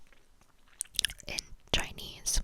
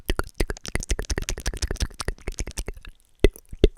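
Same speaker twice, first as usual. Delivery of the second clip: ordinary delivery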